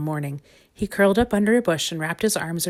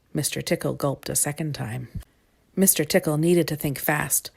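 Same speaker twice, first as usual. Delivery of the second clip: ordinary delivery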